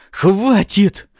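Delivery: high, loud furious command; angry shout. A narrator's reading. Artara flying up to halt the attackers, angry shout. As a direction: explode to stop the attack. Enraged villain halts the attack.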